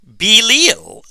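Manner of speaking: Belial squeezed into a high, loud French "bee-leel"; mock French accent on Belial, like bee-leel. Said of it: "with, like, a weird French accent".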